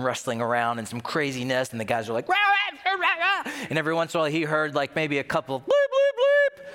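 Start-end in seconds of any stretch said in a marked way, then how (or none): 2.3-3.5 high, loud babbled rah rah; mock panic clamor. Sailors panicking, mocked. 5.7-6.6 very high, loud bleep sound; imitated bleep.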